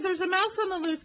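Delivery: ordinary delivery, nothing marked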